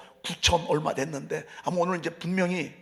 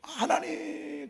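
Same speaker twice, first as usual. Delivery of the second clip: put-on child voice; 10 semitones higher, 5 dB quieter than usual. Child moved during worship.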